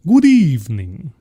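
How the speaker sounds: drawn-out theatrical hello; hammed-up greeting. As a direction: stretch it out in a put-on voice. Playful repeat of the greeting.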